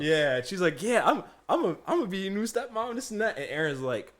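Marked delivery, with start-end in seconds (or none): 0.8-3.4 high-pitched mock boast; teasing coworker. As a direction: imitate her playfully staking a claim. Desiree teases about becoming stepmom.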